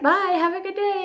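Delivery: high sing-song farewell imitation; cheery goodbye. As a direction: mimic chirpy churchgoers.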